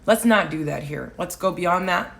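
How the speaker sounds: ordinary delivery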